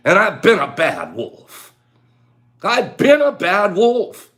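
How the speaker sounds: loud, slow and playful; playful loud confession. Acted. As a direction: own up to being a bad wolf, hammed up.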